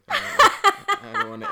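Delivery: high squealing laugh. Real, not acted.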